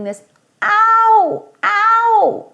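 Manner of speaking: high, loud mock cry of pain. Shown as a demonstration. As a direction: yelp like you stubbed your toe. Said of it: "the sound you make when you stub your toe".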